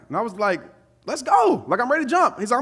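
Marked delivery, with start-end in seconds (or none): 1.0-2.4 high-pitched, raring to go; retold impatient readiness to jump; eager urging.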